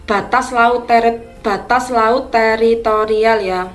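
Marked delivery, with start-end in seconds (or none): none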